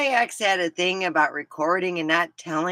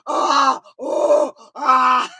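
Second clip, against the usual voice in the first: loud, high mock moaning; imitated moans; 4 semitones higher, 7 dB louder, much slower than usual. Storyteller imitating herself on the mixed-up tape, loud moans. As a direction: moan in short bursts, hammed up. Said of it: "And it's me going".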